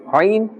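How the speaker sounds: "ayn" pushed from the middle of the throat; emphasised ayn. Shown as a demonstration. Teacher stresses the ayn for students. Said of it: "a little bit more emphasis must be placed".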